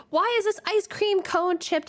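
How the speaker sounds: high-pitched nitpicking rant; mock angry boss. Boss yelling about a damaged cone, mock angry. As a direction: imitate the boss's petty outburst. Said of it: "He would, like, get angry and yell at us".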